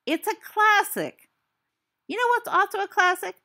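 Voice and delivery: exasperated it's-a-classic; indignant insistence. Real, not acted. Insisting against friend's mockery.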